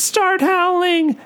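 high, slow scared plea; frightened Todd. A narrator's reading. Todd urges his friend to howl, high and scared. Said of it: "Todd whispers".

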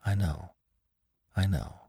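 quiet murmur bent over the box. Acted. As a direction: hush it down over the dying man. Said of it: "said McClure quietly over him".

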